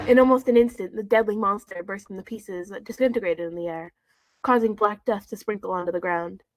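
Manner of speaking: reading a fanfic aloud to the audience; story reading. Acted. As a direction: read the story straight through.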